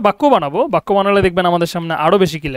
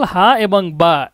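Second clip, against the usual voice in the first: slow, separated letter names; letters spelled out slowly; much slower than usual. Shown as a demonstration. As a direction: say each Arabic letter slowly and apart. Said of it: "এই যে জাল". (প্রতিটি অক্ষর ধীরে আলাদা করে)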